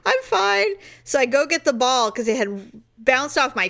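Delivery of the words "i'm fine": high-pitched mock reassurance; high, unconvincing retold reply; insisting she's fine. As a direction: squeak out a reply after a knock. Retold dazed reply after hit.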